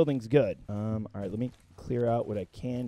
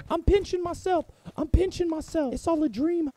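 much higher-pitched mock dreamer; put-on high voice; 14 semitones higher than usual. Gamer pretending it is all a dream, put-on high voice.

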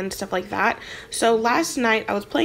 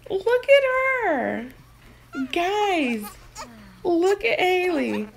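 cooing voice; 8 semitones higher, slower than usual. Real, not acted. Cooing over the baby sim.

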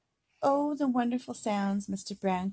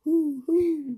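owl hoot; 6 semitones higher, 6 dB louder than usual. Pretend. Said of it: "He can sound like an owl".

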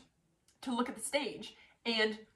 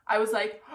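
gasp; 6 dB louder than usual. Real, not acted. Moment she hears she won.

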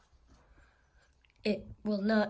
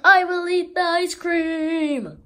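higher, much louder proclamation; a big triumphant shout; loud declaration; 6 semitones higher, 13 dB louder than usual. Acted. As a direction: announce it to the world. Character decides loudly.

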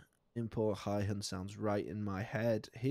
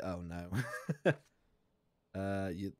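ordinary delivery

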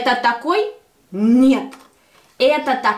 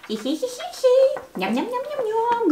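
high giggling munch; giggly toy voice; 10 semitones higher, 4 dB quieter than usual. Pretend. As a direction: play a tiny giggling eater.

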